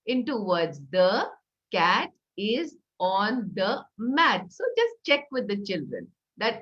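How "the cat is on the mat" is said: slow, each word separated. Shown as a demonstration. Teacher counting words on pebbles, slow and separated. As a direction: space out every word like a stone. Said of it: "dividing what you're saying ... into words".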